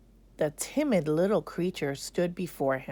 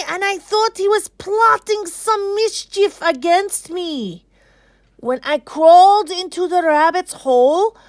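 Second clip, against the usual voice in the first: high, loud, wailing snake voice; aggrieved python; 13 semitones higher, 12 dB louder than usual. Acted. Python defending itself to the king's council, high loud wail. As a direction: protest your innocence in a very high, loud voice.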